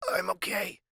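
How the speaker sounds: soft croaky voice, throat swollen. Acted. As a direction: rasp it out after choking. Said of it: "talk in the croaky voice".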